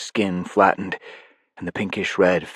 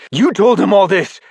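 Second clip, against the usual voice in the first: much higher and louder outrage; furious shout; 12 semitones higher, 8 dB louder than usual. Acted. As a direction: yell at the betrayer. Narrator confronts traitor.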